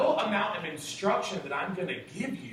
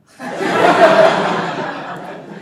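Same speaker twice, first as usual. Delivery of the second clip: loud squealing laugh; cracking up high and loud; high laughing burst; 13 semitones higher, 16 dB louder than usual. Real, not acted. Speaker to the room laughing hard at himself. Laughing at his own reaction.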